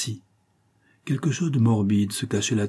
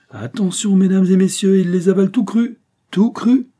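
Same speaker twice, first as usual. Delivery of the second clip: loud, high showman's patter; imitating a carnival barker; 10 semitones higher, 8 dB louder than usual. A narrator's reading. Brissenden mocks Martin as a snake eater's barker, loudly proclaiming. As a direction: call out to the crowd like a sideshow announcer. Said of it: "imitant le bonimenteur d'un fameux mangeur de serpents".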